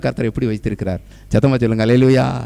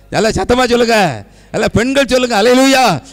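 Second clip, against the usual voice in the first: high, loud rallying hallelujah; preacher's high-pitched crowd call; rousing shout; 8 semitones higher, 6 dB louder than usual. Real, not acted. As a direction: shout to get the women answering louder. Demanding louder response from the women.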